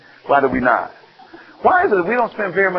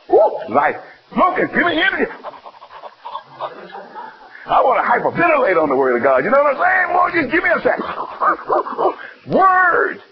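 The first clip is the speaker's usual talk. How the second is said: ecstatic preaching; 8 semitones higher than usual. Real, not acted. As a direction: cry out like you can't breathe. Preacher ecstatic about the Word.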